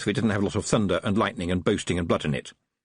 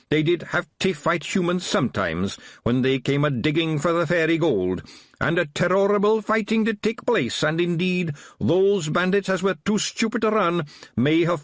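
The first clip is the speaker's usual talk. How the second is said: higher-pitched Scottish little fighting man; Wee Mad Arthur; 9 semitones higher than usual. A narrator's reading. Small Feagle policeman to Tiffany, higher Scottish brogue.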